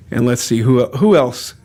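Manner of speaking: ordinary delivery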